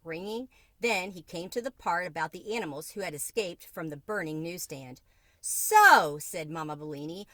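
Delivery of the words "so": a loud, high, pointed so; sharp angry so. A narrator's reading. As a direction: turn the despair into accusation on one word. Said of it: "all her despair changing into anger".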